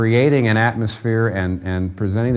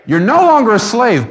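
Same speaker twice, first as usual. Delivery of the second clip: preaching shout; 12 semitones higher, 7 dB louder than usual. Real, not acted. Preacher exhorting the congregation.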